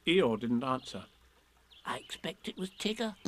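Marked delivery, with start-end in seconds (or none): 1.8-3.1 soft, high, timid squeak; a small frightened pig; nervous. A narrator's reading. Piglet suggests Tigger, nervous and soft. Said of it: "said Piglet nervously".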